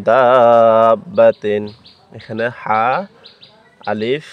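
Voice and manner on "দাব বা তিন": দাব বা তিন recited very slowly and louder; slow drawn-out recitation of দাব বা তিন; four-count madd. Shown as a demonstration. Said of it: "চার আলিফ টানি পড়তে হবে".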